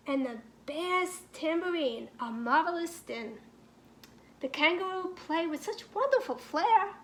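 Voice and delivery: ordinary delivery, nothing marked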